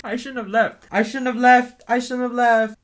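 high, loud repeated lament; distressed regret. Acted. Regretting having left, high distressed wail.

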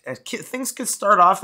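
normal talking voice

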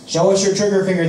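fast, high, loud yelling; crowd yell. A narrator's reading. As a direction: shout it at him fast. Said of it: "they yell".

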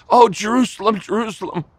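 high impassioned call, falling away on the repeat; passionate cry. Acted. Preacher replaying Jesus' lament over Jerusalem, passionate cry. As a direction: cry the name out high, then let it drop. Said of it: "the passion had".

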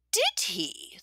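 high, eager questioning voice; pry with relish; delighted old widow. A narrator's reading. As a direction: innkeeper widow asking Bear for gossip. Widow curious.

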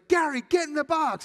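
high, loud shout at a player; coach's yell. Retold or imitated. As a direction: imitate an impatient coach yelling. Coach yelling at hesitant batter.

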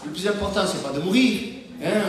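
ordinary delivery

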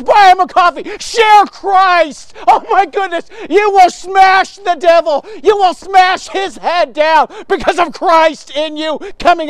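high, loud, fervent exhortation; passionate, zealous preaching. Real, not acted. Preacher urging men to love their betrayers, passionate and zealous. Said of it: "You're being a little passionate and zealous".

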